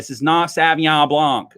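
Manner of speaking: ordinary delivery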